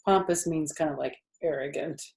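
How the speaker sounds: ordinary delivery, nothing marked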